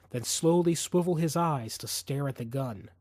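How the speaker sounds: normal talking voice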